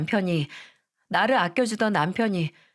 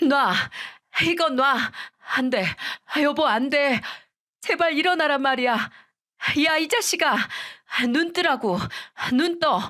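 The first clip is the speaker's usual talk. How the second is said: frantic wailing; 6 semitones higher than usual. Retold or imitated. Widow shaking off her mother-in-law, frantic wailing cries. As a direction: push her off and scream at him to wake. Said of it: "거의 울부짖는 모습에".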